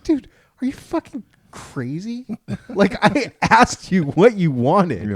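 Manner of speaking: can't believe what he's hearing; incredulous. Real, not acted. Husband venting to friends about his wife, high incredulous pitch.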